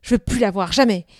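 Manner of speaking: loud, bitter grumbling; resentful, forceful outburst; angry brooding. A narrator's reading. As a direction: fume about being let down. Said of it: "Il rumina de colère".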